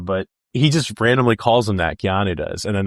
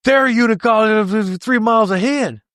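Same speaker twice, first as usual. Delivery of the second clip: high-pitched, rushed Southern maniac impression; imitating an actor's insane, barely intelligible delivery; garbled rushed delivery; 12 semitones higher than usual. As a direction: blurt it out as a garbled, high-pitched movie line. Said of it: "this guy's just delivery and how rushed it is".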